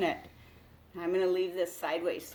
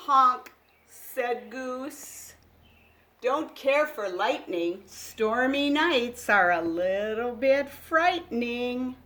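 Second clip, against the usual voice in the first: put-on louder, lively read-aloud for small children; picture book reading; 3 semitones higher, 9 dB louder, slower than usual. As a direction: read it like a bedtime storybook. Reads animal dialogue.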